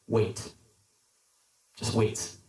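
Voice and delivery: low, unhurried, understated reply; calm master. Retold or imitated. Master answering the screaming clay, calm and low.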